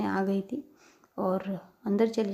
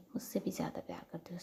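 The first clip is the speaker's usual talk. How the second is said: breathy whisper, much softer; 3 semitones lower, 10 dB quieter, faster than usual. Retold or imitated. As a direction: whisper it tenderly. Loving quote, voiced lovingly. (धीमी फुसफुसाहट)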